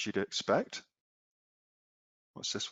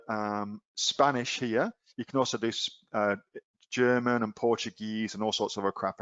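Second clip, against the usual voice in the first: normal talking voice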